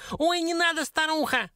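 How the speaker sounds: very high, frantic squeal; squeaky, panicked little creature; squealing dwarf. A narrator's reading. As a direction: shriek for mercy like a tiny imp. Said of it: "заверещал тот".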